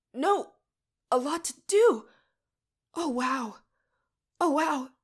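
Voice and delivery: high loud breathless awe; overwhelmed, voice climbing. A narrator's reading. Mariah overwhelmed in trance.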